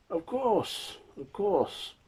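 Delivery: put-on high, obliging old fellow; blind old father. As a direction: agree in a high old man's voice. Blind father answers in character.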